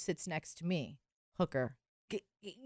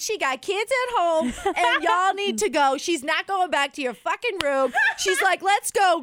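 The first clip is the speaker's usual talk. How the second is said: loud, high sassy telling-off; the hooker scolding the guys, loud and sassy; sassy protector; 10 semitones higher, 5 dB louder than usual. Retold or imitated. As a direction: shoo them off with attitude.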